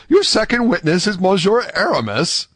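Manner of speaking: high, incredulous question; greater astonishment. A narrator's reading. D'Artagnan asking about the second witness, greatly astonished. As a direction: ask in amazement, pitched right up. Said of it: "an accent of greater astonishment".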